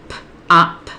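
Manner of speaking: "p, op" with the first sound cut off from the rest; segmented sounds. Shown as a demonstration. Teacher sounding out pop for writing, in pieces. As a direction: say "p" alone, pause, then "op". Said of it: "they need to hear it segmented".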